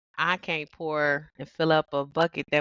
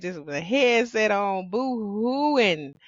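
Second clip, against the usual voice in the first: normal talking voice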